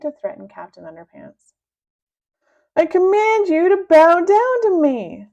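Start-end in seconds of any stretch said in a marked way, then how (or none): 2.8-5.3 high, loud commanding shout; a bully's booming command. Acted. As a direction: bellow the order down at him. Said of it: "shouted Big Melvin".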